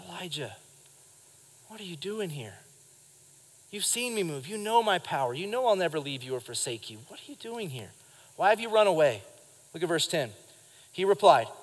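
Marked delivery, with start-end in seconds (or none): 0.1-9.2 much softer, tender and caring; gentle God voice. Shown as a demonstration. God gently asking Elijah why he ran, tender and soft. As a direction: ask it like a loving father, not a scolding one. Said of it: "I believe it was just".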